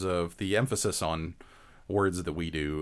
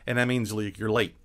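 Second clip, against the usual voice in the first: ordinary talk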